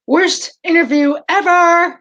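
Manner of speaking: joking gripe while the call is down; mock complaint. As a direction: pretend to be fed up, teasing. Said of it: "No, I'm just kidding".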